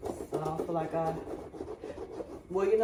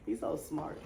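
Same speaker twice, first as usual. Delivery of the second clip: normal talking voice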